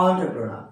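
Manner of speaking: "এন্টারপ্রেনার" said as "on-tre-pro-na"; correct pronunciation. Shown as a demonstration. Teacher giving learners the real form, "এন্টারপ্রেনার" as on-tre-pro-na. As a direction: say "এন্টারপ্রেনার" starting "on-tre", no final r. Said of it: "কি হবে?".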